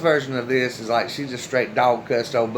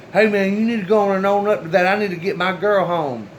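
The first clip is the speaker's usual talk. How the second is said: high, loud and fast call across the road; yelling out; 6 semitones higher, 3 dB louder than usual. Retold or imitated. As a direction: shout it fast, impatient. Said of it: "he yells out".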